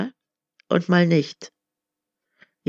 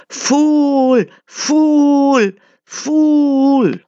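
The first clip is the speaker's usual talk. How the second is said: much higher, loud mocking scream; 9 semitones higher, 8 dB louder, much slower than usual. A narrator's reading. The crowd screams at the blind wanderer, furious mockery. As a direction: scream it at him in scorn. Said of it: "they screamed".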